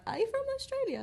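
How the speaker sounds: high-pitched mock inquiry; lightly acted puzzled asking; imitated curious question. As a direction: imitate a stranger guessing, voice leaping high. Acting out people guessing her origin.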